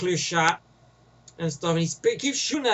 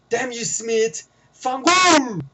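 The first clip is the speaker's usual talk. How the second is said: mock Italian outburst; 4 semitones higher, 5 dB louder, much slower than usual. Quoting the woman cursing Smith.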